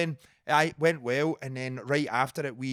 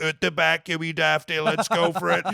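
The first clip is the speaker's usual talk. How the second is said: louder, higher, hammed-up tough-guy challenge; mock Donnie threat; 4 semitones higher, 6 dB louder than usual. As Donny, squaring up to a cheeky fan, in mock aggression. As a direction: double down like a pub hardman, half joking. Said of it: "gives me a bit of cheek as Donny".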